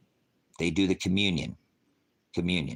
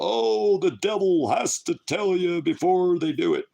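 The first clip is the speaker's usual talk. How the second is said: higher, mocking singsong; mocking gullible people; 10 semitones higher, 4 dB louder than usual. Streamer mocking people who repeat the claim, higher mock voice. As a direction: parody a naive believer. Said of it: "Stop telling people".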